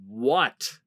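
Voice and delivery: usual speaking voice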